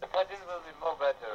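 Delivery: usual speaking voice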